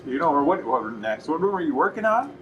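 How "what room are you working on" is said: higher, bright friendly ask; helpful salesperson question. Shown as a demonstration. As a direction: offer help with an upbeat lift. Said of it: "setting the intention".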